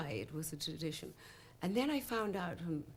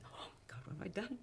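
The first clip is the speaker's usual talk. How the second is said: whispered, breathless dismay; panicked whisper; 2 semitones higher, 13 dB quieter than usual. Retold or imitated. Singer panics in the silent hall, in a shocked whisper.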